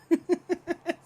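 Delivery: high-pitched burst of giggles; real high, chuckling laugh. Real, not acted. Laughs at her being caught lying.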